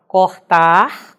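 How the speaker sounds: CORTAR slow and loud, ending in a clear R; final R pronounced. Shown as a demonstration. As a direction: sound out the infinitive cortar with its final R. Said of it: "terminando em R".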